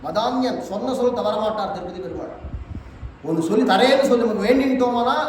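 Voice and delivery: ordinary delivery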